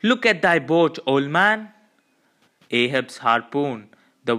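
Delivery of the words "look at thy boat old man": raised, higher urgent plea; urgent call. A narrator's reading. First mate urges old Ahab to look, raised voice. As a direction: keep crying out the warning. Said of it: "cried Starbuck".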